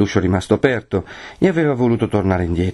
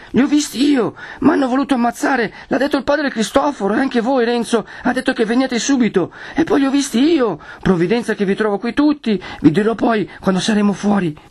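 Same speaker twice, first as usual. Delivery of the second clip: put-on high-pitched boy in a panic; Menico, panting alarm; 14 semitones higher than usual. A narrator's reading. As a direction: pour it out in a sustained high, frightened voice. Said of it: "riprese Menico ansante". (voce acuta di ragazzo spaventato)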